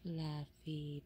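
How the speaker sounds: low, soft, tender call of the name. Real, not acted. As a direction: murmur the name gently.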